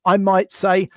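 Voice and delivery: ordinary talk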